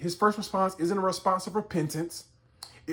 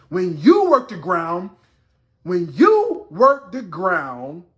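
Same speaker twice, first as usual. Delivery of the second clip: impassioned preaching; 8 semitones higher, 8 dB louder than usual. Acted. Preacher delivering God's punishment on Cain, full force. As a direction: drive it home, drawing out the heavy words.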